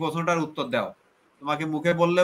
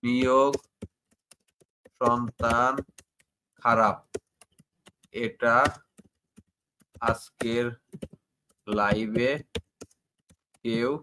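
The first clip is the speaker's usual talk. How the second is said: slow, word by word, lower, with long gaps; dictating while typing; 5 semitones lower, much slower than usual. Real, not acted. Host dictates the quoted question while typing it. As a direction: spell it out word by word as you type. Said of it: "আমি inverter কমার ভিতর লিখে দিই".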